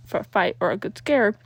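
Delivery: ordinary delivery, nothing marked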